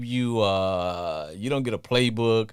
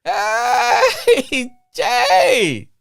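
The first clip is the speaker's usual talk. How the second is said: loud, high welcome shout; excited greeting; 11 semitones higher, 8 dB louder, much slower than usual. Real, not acted. Radio host loudly greets his caller Jay, excited.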